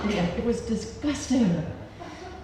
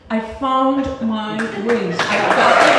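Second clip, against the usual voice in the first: slow, drawn-out victory cry building louder; triumphant announcement; 3 semitones higher, 6 dB louder than usual. Retold or imitated. Storyteller shows off long-lost rings to audience, triumphant announcement. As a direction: proclaim it slowly, then burst out loud.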